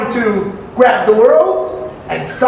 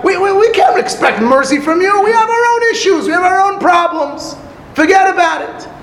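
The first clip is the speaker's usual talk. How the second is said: much higher, stammering shout of refusal; flustered refusal; 8 semitones higher than usual. Acted. The hills brushing off the penitent, flustered shout. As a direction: wave him off in a panic.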